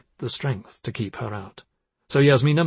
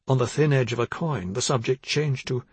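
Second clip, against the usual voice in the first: usual speaking voice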